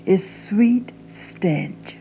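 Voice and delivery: slow whisper; a slow, hushed whisper. Acted. Describing the South.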